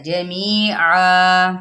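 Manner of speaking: जमी आ drawn very slowly, ending on long आ; stopping with long a. Shown as a demonstration. Teacher shows the stop on जमी आ, drawn out. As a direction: hold the final आ long. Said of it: "Waqf bill इबदाल".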